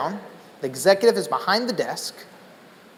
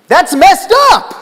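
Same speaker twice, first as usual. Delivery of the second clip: much higher, louder, slow indignant blurt; outburst; 13 semitones higher, 11 dB louder, much slower than usual. Retold or imitated. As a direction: burst out in shock at the injustice. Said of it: "an outburst like that".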